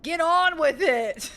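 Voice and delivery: high, loud, slow yell to hurry up; impatient yell. Real, not acted. Friend yelling at the player to hurry up, loud. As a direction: shout at them to get on with it. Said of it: "impatient yell at player".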